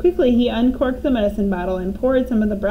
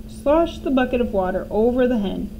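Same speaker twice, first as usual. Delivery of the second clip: plain reading voice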